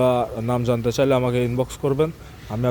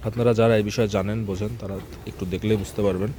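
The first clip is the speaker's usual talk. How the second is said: low, hushed talk near an animal; speaking softly; 5 semitones lower, 6 dB quieter than usual. Real, not acted. Farmer talking beside a cow being milked, speaking softly. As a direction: keep it down so as not to disturb. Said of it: "আমি একটু আস্তে আস্তে কথা বলছি".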